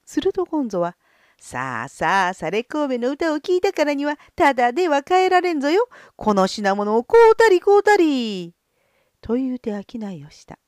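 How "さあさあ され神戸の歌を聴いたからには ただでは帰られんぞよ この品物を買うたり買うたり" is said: street hawker. Acted. Hawking goods to crowd.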